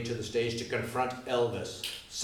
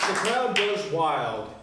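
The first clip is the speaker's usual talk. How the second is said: slow, louder, raised announcement of the crowd; excited narration; 6 semitones higher, 5 dB louder, slower than usual. A narrator's reading. Narrator to audience, excitedly announcing the crowd. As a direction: build the roar into it.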